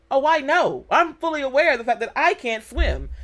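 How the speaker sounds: high, loud retold comeback; emphatic retort. Woman answering her friend that she knows she can't swim, emphatic retort.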